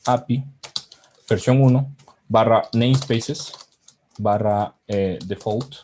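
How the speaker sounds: slow, word-by-word dictation. Real, not acted. Presenter types the API path live, slow dictation. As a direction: spell out the path slowly while typing. Dictating a URL while typing.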